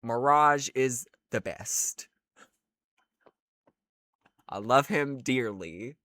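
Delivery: usual speaking voice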